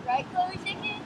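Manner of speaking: very high sped-up cartoon voice; chipmunk voice. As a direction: speak like a sped-up tape.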